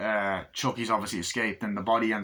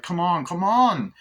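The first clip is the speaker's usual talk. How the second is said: loud, higher, slow urging; urgent urging; 8 semitones higher, 7 dB louder, slower than usual. Retold or imitated. Andy pulls Kyle to safety, urgent loud urging. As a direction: pull her up, come on. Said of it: "he's like".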